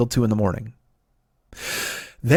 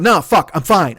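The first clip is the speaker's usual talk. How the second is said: agitated denial; 4 semitones higher, 5 dB louder, slower than usual. Retold or imitated. Snaps at security, retold.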